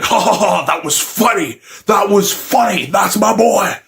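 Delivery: hammed-up loyal laughing dad; high, over-the-top fatherly approval; proud dad Godzilla. Godzilla dad laughing at failed skit.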